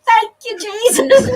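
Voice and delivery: gleeful thanks. Retold or imitated. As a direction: rejoice out loud. Inner joy at the theft.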